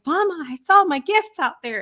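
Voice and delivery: put-on high, excited little girl voice; bright child announcing her gifts; imitating an eager daughter. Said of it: "And she was all excited".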